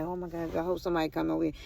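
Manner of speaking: soft, low worried aside; retold silent hope. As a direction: drop low, as if pleading inwardly.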